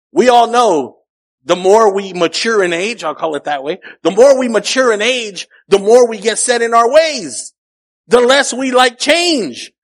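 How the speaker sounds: rising preaching intensity. Real, not acted. Preaching climax building.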